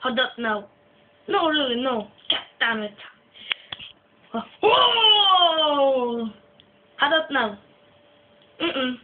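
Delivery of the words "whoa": mock scary howl. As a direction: howl a drawn-out spooky whoa. Mock scare after are you scared.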